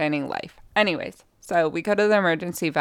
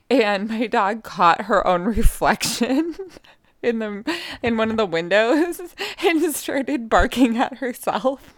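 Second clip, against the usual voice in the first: laughing through the telling; cracking up; 5 semitones higher than usual. Real, not acted. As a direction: try to get the story out through giggles. Cracking up at her dog's antics.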